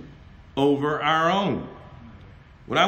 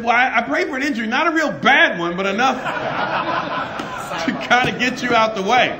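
laughing retold confession; 5 semitones higher, 4 dB louder than usual. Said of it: "he said".

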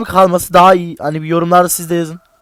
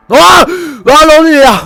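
shrieks high and loud in fright, then a panicked yell; startled real yell; real scared scream; 9 semitones higher, 8 dB louder than usual. Real, not acted. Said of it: "çok bağırabilirim veya küfür edebilirim".

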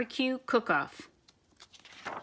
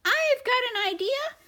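much higher, scolding squeak; squeaky mouse voice; 12 semitones higher than usual. Acted. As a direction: taunt the troops in a tiny voice. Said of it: "squeaked the Big Cheese".

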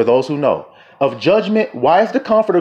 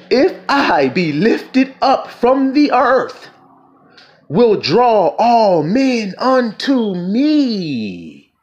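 very slow, high, drawn-out declamation; ringing, long-held preacher's phrases; scripture proclamation; 7 semitones higher, much slower than usual. Acted. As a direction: stretch the words out and let them soar. Proclaims Christ's words from scripture.

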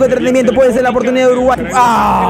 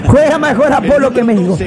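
ordinary delivery

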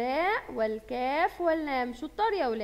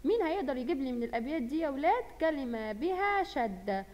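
ordinary talk

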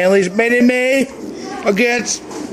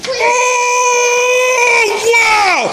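excited cheer. Real, not acted. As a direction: gasp it out at the finish. Cheering the race finish.

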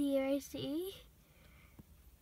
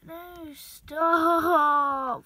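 a much louder, firm shout of no stop; 2 semitones lower, 11 dB louder than usual. Real, not acted. Owner yelling at cat to stop biting, loud shout. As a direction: shout to make the cat quit.

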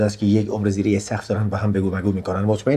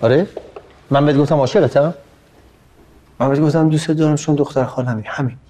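angry denial; 5 semitones higher than usual. Acted.

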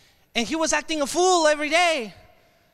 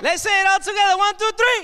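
high, rousing count-in for a chant; rallying the crowd; 7 semitones higher, 4 dB louder than usual. Real, not acted. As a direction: lead the whole room into shouting. Leads audience in a chant.

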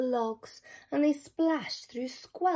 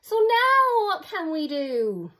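roar; 8 semitones higher, 7 dB louder than usual. Acted. Bushy bored in the sea, roaring. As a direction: bellow it out. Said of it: "Bushy roared".

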